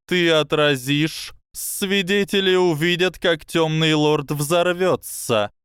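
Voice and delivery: high, slow serpent voice; a hissing snake, slow and sly. Acted.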